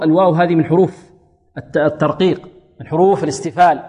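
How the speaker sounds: normal talking voice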